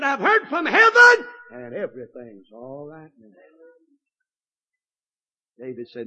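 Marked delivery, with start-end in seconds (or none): 0.0-3.3 exultant cry. Acted. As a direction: burst out with joy then settle. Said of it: "that look said".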